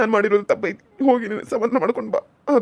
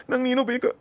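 crying plea; 3 dB quieter than usual. Acted. Husband pleads, crying heard.

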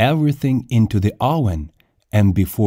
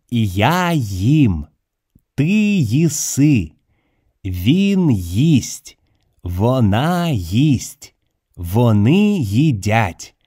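ordinary delivery, nothing marked

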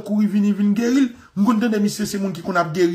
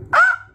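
high shriek; 5 dB louder than usual. Acted. Preacher cries out mid-story.

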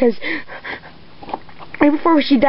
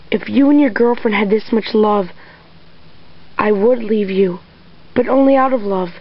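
usual speaking voice

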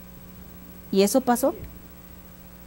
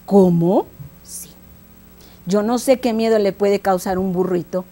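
plain reading voice

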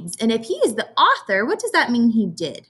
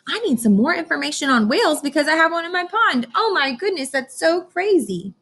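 put-on higher excited child voice; Emily's voice; 8 semitones higher than usual. Teacher retelling Emily's letter, high and excited. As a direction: gush it like an amazed little girl. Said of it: "she's writing her teacher and says".

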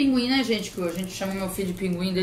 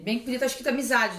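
ordinary delivery

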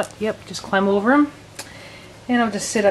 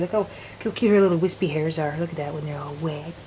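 ordinary talk

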